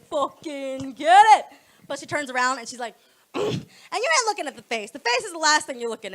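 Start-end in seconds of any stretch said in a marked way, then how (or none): 0.1-1.5 slow bro hype. Retold or imitated. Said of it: "He'd be like".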